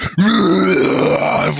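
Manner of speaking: long, acted animal roar; massive bear roar. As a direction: roar like a polar bear over the table. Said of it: "give it this massive".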